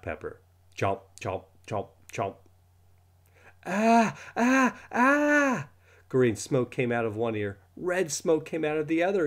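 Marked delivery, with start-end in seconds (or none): 3.6-5.7 high, loud burning-mouth cries; pained shrieks. Acted. Boy yelping after drinking hot pepper sauce, shrill cries. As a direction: yelp as the hot sauce burns.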